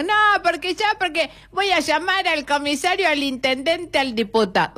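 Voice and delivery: high-pitched protest from someone being thrown out; hammed-up indignation, pitched high; mock indignant customer. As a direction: play the complainer threatening to call officials. Acts out an indignant ejected customer.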